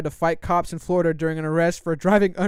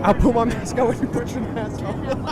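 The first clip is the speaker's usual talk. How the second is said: snide mimic of the angry passenger; mocking Karen voice; 5 semitones higher than usual. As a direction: parrot her line back mockingly. Mimicking the woman from the clip.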